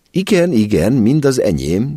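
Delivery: bright high chirp of an eager mother; nervous, cheerful chirping. A narrator's reading. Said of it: "csicseregte mama".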